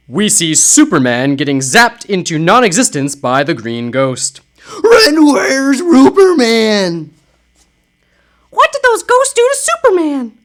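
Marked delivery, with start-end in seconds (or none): 4.8-10.4 high, slow panicked wail; scared for the lost hero. Acted. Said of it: "I'm doing different voices for different people".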